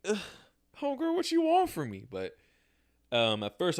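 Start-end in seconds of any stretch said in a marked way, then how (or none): 0.1-2.1 high mock-sassy brush-off, much higher; sassy retort. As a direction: put on a high sassy voice to wave her off. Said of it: "I'm like".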